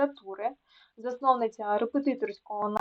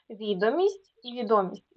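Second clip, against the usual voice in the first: ВІдомість, then відОмість; contrasting stress. Shown as a demonstration. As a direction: show відомість first with initial stress, then middle stress. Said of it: "значення слова залежить від наголосу".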